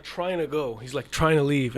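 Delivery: usual speaking voice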